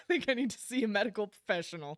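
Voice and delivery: high, loud, laughing through the words; laughing admission. Retold or imitated. Laughing as he admits he needs a medical professional. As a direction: giggle the admission out.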